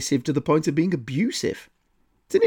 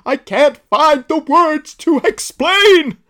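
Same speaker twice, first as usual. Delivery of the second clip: hammed-up high, drawn-out shout; melodramatic meltdown yell; 13 semitones higher, 9 dB louder, slower than usual. Peter yelling at Gwen in a meltdown, melodramatic shout. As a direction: yell it slowly in over-the-top anguish. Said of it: "melodramatic meltdown where he yells".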